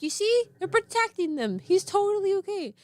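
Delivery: put-on high, bright coaxing to a child; reassuring big sister. Big sister comforting crying brother, high coaxing. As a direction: cheer the crying toddler up. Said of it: "I was like".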